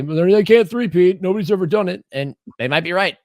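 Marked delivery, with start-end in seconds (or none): 0.0-2.0 doubters' voice. Retold or imitated. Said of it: "everyone's gonna say".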